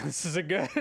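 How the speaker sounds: laughing it off, resigned. Real, not acted. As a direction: chuckle through the words. Laughing at bad draw.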